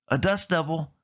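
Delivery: exclaiming with a shiver. A narrator's reading. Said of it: "exclaimed Mater with a shiver".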